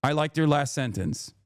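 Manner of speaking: usual speaking voice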